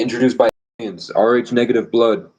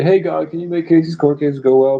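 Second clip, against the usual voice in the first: higher-pitched casual plea to God; mock prayer; 7 semitones higher than usual. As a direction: toss up a quick prayer in a raised voice. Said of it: "Just say".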